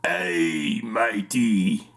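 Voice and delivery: pirate voice. Acted. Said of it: "What's a pirate say".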